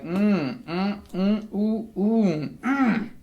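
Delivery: drawn-out crooning mm and ooh sounds; mock-seductive humming; wooing mm and ooh. Shown as a demonstration. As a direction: hum it like a lover. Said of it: "he's like".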